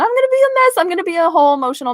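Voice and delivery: high-pitched tearful squeal, speeding up; emotional, near tears. Real, not acted. Mom picturing showing her daughter the letter, near-tears squeal. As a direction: squeal it as if about to cry. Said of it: "I'm gonna start crying and everything".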